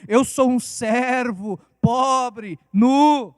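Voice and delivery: slow, humble mock prayer voice; a hint of an old-style humble prayer; pastor Gabriel. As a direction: lightly imitate a pleading servant's prayer. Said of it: "Dá até a entonação".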